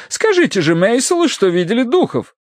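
high, insistent lady's voice; urging wife. Acted. Wife urges priest to confirm spirits, insistent. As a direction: press him to back you up.

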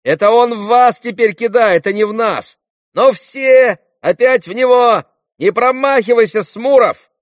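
very high, loud rallying shouts; rally the gang at full yell; boys' battle yells. Acted. Boys shout during stone fight. (азартные крики мальчишек)

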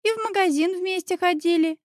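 put-on high-pitched child voice; childlike, high-voiced report; little son. A narrator's reading. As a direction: add it like a small boy. Young son continues his answer.

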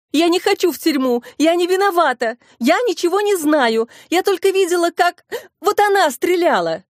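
high-pitched, panicky protest; crying out. A narrator's reading. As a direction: plead innocence in a narrated frantic cry. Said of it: "закричала Клара". (истеричный крик)

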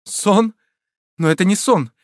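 high, alarmed exclamations; urgent, high-pitched alarm; frightened. A narrator's reading. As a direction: protest in fright. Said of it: "жестом испуга указывала".

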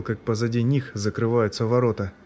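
ordinary delivery

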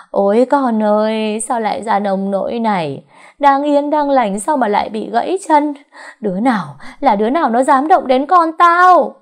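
high, loud wail turning to outrage; wailing mother. A narrator's reading. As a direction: cry out in anguish, building to a furious yell. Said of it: "Bà Hai gào khóc".

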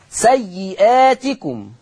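سيئاتكم with the long ā after the hamza drawn out; سيئاتكم in measured tajweed with a lengthened badal vowel; madd al-badal. Shown as a demonstration. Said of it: "مد البدل".

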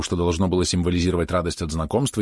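ordinary delivery, nothing marked

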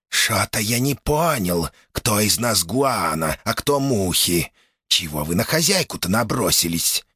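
mock-puzzled creature voice; mantis demon. Mantis demon Shaks speaks. (голос богомола)

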